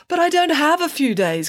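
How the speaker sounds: put-on a boy whining that he can't wait; complaining. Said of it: "complained Parker".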